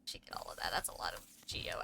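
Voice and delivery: fast whisper to self. Real, not acted. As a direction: whisper it quickly. Muttering to self.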